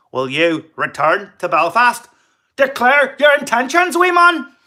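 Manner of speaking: loud, high Northern Irish threat; threatening Belfast voice, shouted high; Belfast accent. Acted. Voicing a threatening Belfast man.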